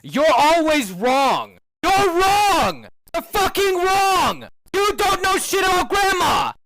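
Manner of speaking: from a firm insist up to a high shout; building angry rant. Real, not acted. Ranting furiously at Ruby.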